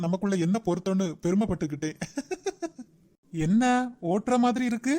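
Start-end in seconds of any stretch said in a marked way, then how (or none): 2.0-2.8 light, high chuckle; a soft burst of giggly laughter; amused chuckle. Acted. Pleased friend on the phone to his buddy, amused chuckle.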